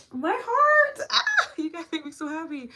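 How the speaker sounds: high, loud laughing burst of joy; overjoyed laugh. Real, not acted. Host reading loving comments, laughing with joy. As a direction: spill over with delight.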